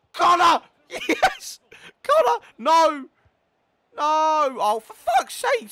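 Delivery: very high, loud outbursts; frantic yelling. Real, not acted. Gamer urging Connor on then failing, frantic yelling. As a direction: scream at the players in panic. Frantic shouting during play.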